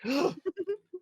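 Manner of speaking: sharp high gasp; mock offended gasp. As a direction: gasp in fake shock. Mock outrage at being scolded.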